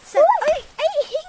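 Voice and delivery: sharp loud high cry of protest; real yell. Real, not acted. Girl yells at people stepping on the plants, loud protest. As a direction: yell to stop them at once. Child yelling at others stepping.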